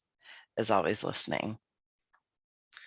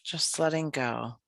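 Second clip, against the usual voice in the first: calm, unhurried yoga nidra voice with long pauses; guided meditation. Acted. Leads a guided relaxation practice.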